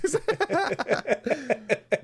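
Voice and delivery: high giggling laugh. Real, not acted. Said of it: "laughing after fake Japanese".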